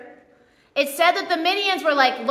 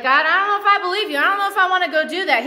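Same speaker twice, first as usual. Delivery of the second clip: scaredy cat; 3 semitones higher, 3 dB louder, much faster than usual. Retold or imitated. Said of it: "he's a scaredy cat".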